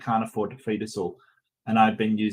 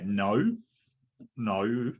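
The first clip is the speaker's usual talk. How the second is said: drawn-out Australian "No" with a gliding end; Australian vowel; 3 semitones lower, 4 dB quieter than usual. Shown as a demonstration. Host testing the accent viewers noticed, Australian vowel. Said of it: "you add that Australian vowel sound".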